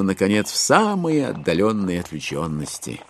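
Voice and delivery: ordinary delivery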